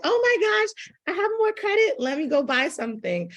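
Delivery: high-pitched excited squeal. Retold or imitated. Shopper thrilled by a brand's text, excited squeal. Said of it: "I get excited".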